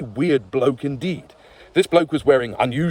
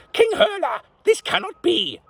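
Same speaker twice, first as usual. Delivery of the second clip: much higher, mock thundering lord. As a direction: thunder it like a panicked knight. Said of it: "thundered Lord Basil".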